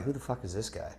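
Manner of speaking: the stares of the lawyers, voiced as a hushed mutter; muttered aside. Retold lawyers muttering about him.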